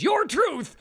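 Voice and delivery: "your truth" with both words punched; stress on YOUR TRUTH. Shown as a demonstration. Host explaining his emphasis, stressing both YOUR and TRUTH. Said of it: "I emphasize both your and truth".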